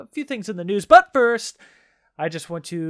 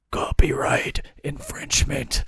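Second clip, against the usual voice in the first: whisper; 5 semitones lower than usual. Acted. Spooky reveal, whispered.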